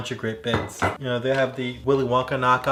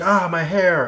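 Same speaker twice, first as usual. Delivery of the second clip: higher, louder hammed-up yelp; mock cry of pain; 6 semitones higher, 6 dB louder, slower than usual. Host retelling Futurama, Fry's hair burning, mock yelp. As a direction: cry out as your hair catches fire. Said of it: "he goes".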